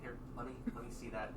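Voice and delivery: quiet kobold. Acted. GM voices small kobold.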